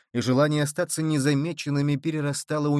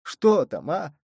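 moaning; 3 semitones higher, slower than usual. A narrator's reading. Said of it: "снова простонал раненый".

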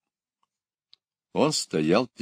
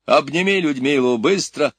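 loud urgent order, pitch raised; commanding; 6 semitones higher, 5 dB louder than usual. A narrator's reading. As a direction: give the order loudly and urgently. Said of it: "приказал Кюхельбекер". (громкий срочный приказ)

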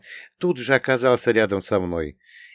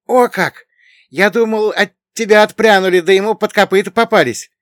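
very high, loud astonishment; amazed; 13 semitones higher, 11 dB louder than usual. A narrator's reading. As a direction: burst out in high-pitched wonder. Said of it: "С удивлением глянул".

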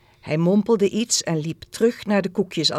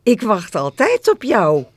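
higher, louder glad declaration; joyful girl's reply; happy; 4 semitones higher, 7 dB louder than usual. A narrator's reading. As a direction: declare it with delighted devotion. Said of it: "zei het meisje blij".

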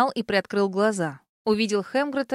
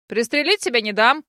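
loud objection; 4 dB louder than usual. A narrator's reading. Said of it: "громко возразил Дэн".

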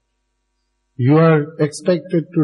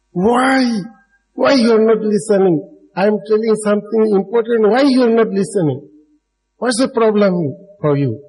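high-pitched imitation of an exasperated teacher's scolding; mock-angry, plaintive demand, pitched well up; Krishnamurti angrily scolding; 6 semitones higher than usual. Imitating a teacher stopping inattentive listeners mid-talk, angry. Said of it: "he becomes very angry".

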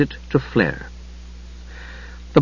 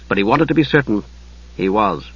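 plain reading voice

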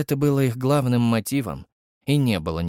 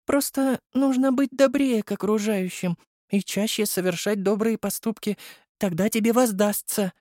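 gently voiced young girl; Nastya's voice; 10 semitones higher than usual. A narrator's reading. Nastya lectures him. (высокий девичий голос)

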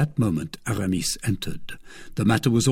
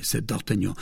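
ordinary delivery, nothing marked

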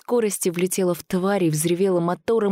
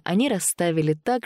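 ordinary delivery

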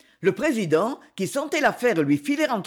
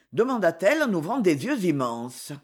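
ordinary delivery

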